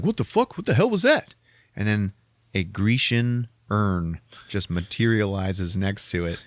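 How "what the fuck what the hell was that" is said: high startled outburst; much higher, alarmed; startled teens. Retold or imitated. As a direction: jump at the flash. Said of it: "They're like".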